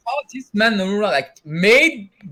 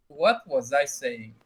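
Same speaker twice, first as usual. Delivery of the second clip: ordinary talk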